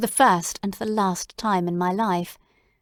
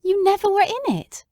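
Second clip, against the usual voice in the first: high, louder incredulous question; can't believe what he hears; astonished; 11 semitones higher, 4 dB louder than usual. Acted. Watson astonished that Holmes never fell, high incredulous.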